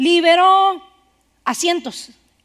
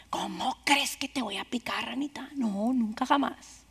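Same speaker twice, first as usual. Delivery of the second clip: much softer, near-whispered promise; sly scorpion; 3 semitones lower, 11 dB quieter than usual. A narrator's reading. Scorpion secretly reassuring the frog he won't sting, sly whisper. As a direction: hush it like a liar soothing his victim.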